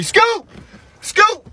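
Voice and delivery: put-on high-pitched call for a missing child. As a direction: call out sharply, pitch leaping up. Calling for hidden daughter.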